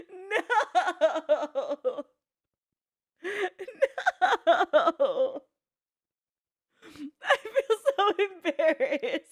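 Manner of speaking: high, loud laughing groans; giggling, mortified; laughing embarrassed. Real, not acted. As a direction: laugh through the shame. Laughing at being like orcs.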